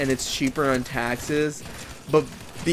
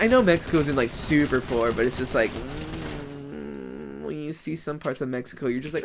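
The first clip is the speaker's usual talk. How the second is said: ordinary talk, nothing marked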